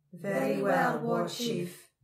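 loud, high, echoing many-voiced crone; layered, echoing proclamation; combined crone voices. A narrator's reading. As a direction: intone it as a chorus of crones. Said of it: "in the strange combination voice of the masked crones".

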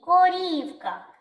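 "Корівка" called slow and high, long І; calling out. Shown as a demonstration. Teacher confirms the stressed І, high calling "коРІІвка". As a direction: stretch ко-РІІІВ-ка as a call. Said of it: "Тут наголошений звук І".

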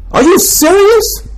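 disbelief. Real, not acted. Mocking disbelief at sneaker buyers.

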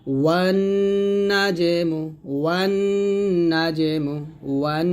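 measured "ওয়ান" with lingering nasal ending; ghunna. Shown as a demonstration. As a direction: hum the n of "ওয়ান" before "নাজমু". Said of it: "গুণা করবেন".

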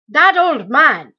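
loud, slow outraged cry; cried out. A narrator's reading. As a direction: bellow it across the room at the old man. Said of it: "he cried".

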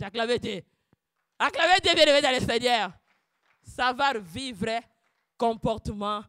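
loud praise call; fervent preacher's appeal; preaching call. Acted. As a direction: stir the congregation.

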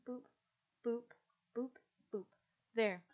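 ordinary talk, nothing marked

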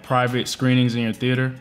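ordinary talk, nothing marked

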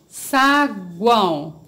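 SAGUÃO stretched slowly but unbroken; whole word, slowly. Shown as a demonstration. Teacher presenting a word to pupils, slow and whole. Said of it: "Vamos separar".

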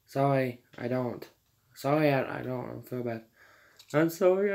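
ordinary delivery

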